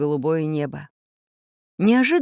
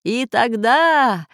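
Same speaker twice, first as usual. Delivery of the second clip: slow, raised, drawn-out villain call; menacing threat; 6 semitones higher, 3 dB louder, much slower than usual. A narrator's reading. Plant master blown away by storm, calling threats. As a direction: stretch each threat into the wind. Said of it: "Донеслись сквозь ветер последние угрозы".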